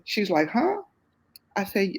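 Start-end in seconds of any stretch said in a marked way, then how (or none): 0.5-0.8 high, stunned mock huh; shocked huh. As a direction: jolt up in disbelief. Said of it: "She was floored".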